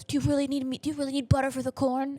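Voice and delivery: high-pitched exasperated movie dad; Matt Damon. Retold or imitated. Dad driving family to buy butter, mock exasperation. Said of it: "Matt Damon goes".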